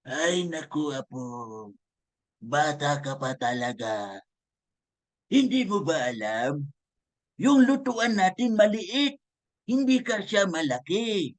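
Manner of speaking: slow, put-on old lolo voice; slow old-man storyteller voice with a climb in pitch near the end; grandfather's voice. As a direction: play the grandpa gently explaining to his grandchild. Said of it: "Ano sabi ng lolo?".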